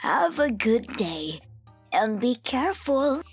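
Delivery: high, slow, friendly call; old lady kindly calling to passing children; drawn-out, lilting send-off. A narrator's reading. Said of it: "she kindly called out to us".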